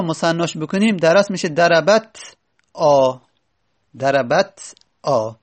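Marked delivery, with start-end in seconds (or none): 1.5-3.2 "دربت آ" with a vowelless ت, then a separate آ; unvowelled final ت. Shown as a demonstration. Teacher breaks down the word's underlying form, "دربت آ" split at silent-vowel ت. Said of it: "بعد ت تا ساکنه". 4.0-5.3 slow "دربت", stop on ت, then a detached آ; unvowelled final ت. Shown as a demonstration. Teacher showing the ت has no vowel, slow split "دربت آ". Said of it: "بعد ت تا ساکنه".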